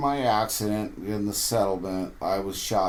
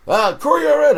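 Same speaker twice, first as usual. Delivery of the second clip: high, loud worried call to a hurt man; loud retold check on a fallen friend; alarmed call; 11 dB louder than usual.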